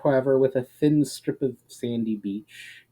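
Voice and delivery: ordinary delivery, nothing marked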